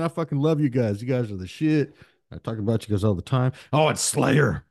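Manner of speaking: normal talking voice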